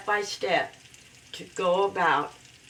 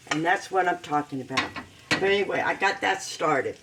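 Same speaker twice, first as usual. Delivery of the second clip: normal talking voice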